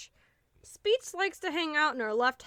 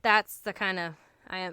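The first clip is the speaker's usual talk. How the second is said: ordinary talk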